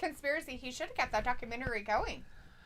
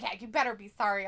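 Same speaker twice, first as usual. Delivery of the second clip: normal talking voice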